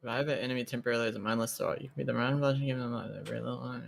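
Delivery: fast, very soft mumbled read; reading to oneself. Real, not acted. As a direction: rattle through it under your breath. Reading rules text to themself.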